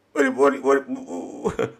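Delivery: soft stuck repeats of "what"; stuttering. Real, not acted. Host trying to question Amanda, stuttering. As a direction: trip over the word before getting going. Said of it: "I'm even stuttering".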